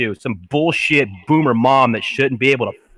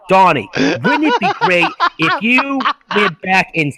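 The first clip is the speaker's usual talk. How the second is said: higher-pitched mock mom coaxing her son; boomer mom; 5 semitones higher than usual. Mom pitching a plan to son Donnie, mock boomer mom. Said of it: "what one of these bitches said".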